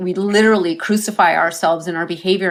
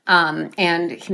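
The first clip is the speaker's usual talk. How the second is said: usual speaking voice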